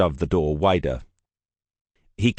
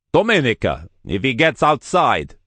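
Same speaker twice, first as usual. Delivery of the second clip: louder called command; calling out; 6 semitones higher, 4 dB louder than usual. Acted. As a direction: call the order across the church. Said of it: "he called".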